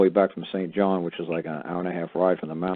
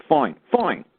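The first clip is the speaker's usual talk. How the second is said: mocking the clerk; 9 semitones higher, 6 dB louder than usual.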